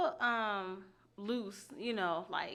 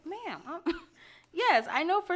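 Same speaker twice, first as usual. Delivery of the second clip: incredulous reply; 9 semitones higher, 4 dB louder than usual. Retold or imitated. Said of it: "I'm like".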